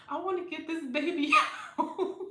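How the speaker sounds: loud, high, laughing through the words; half-desperate and cracking up; laughing urgency. Retold or imitated. Mother in labor wanting baby out, loud and laughing. Pushing in front of everyone.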